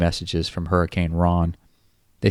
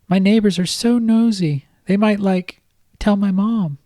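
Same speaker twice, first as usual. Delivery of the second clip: much higher girlish voice with a hint of a man underneath; a grown man faking a little girl, pitched way up; babyish high voice. Kyle faking Kate's voice on the phone to Ron, high and babyish. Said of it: "his Cuddly Kate voice holding".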